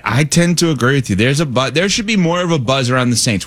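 usual speaking voice

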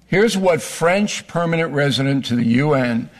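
ordinary talk

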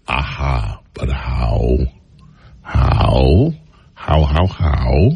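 pointed low-pitched scepticism; sceptical probing. Real, not acted. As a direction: drop low and repeat it suspiciously. Sceptical interjection after the quote.

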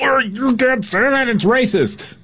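high, drawn-out wail of protest; mock outrage. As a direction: wail it like a scandalized objector. Mimics outraged people objecting.

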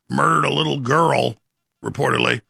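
ordinary delivery

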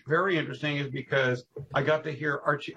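ordinary talk, nothing marked

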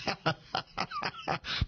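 soft chuckling laugh. Real, not acted. Host amused by his own name correction, laughing. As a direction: break into a quiet run of chuckles.

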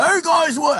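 high-pitched, half-hearted celebrity impression; Doc Rivers impression. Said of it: "I can't do the Doc Rivers impression".

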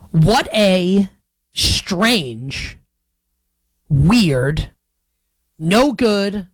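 slow, drawn-out list with long dramatic pauses; mock-dramatic build-up. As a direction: savour each insult like a eulogy.

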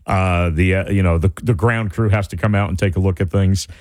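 low pilot voice over the intercom; low, even cabin announcement with filler uhs. Retold or imitated. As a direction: imitate an apologetic pilot addressing passengers. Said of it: "the pilot comes back on".

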